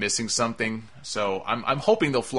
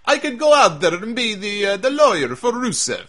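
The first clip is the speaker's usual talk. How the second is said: higher, louder mock Russian accent; fake Russian accent; 7 semitones higher, 4 dB louder than usual. Reviewer showing he can fake the lawyer's Russian accent, mock Russian accent. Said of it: "I can fake a Russian accent".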